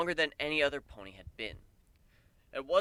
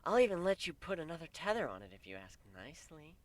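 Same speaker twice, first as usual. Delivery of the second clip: higher teasing mare voice; teasing Pegasus mare voice; playful mare voice; 6 semitones higher than usual. A narrator's reading. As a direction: coax him playfully.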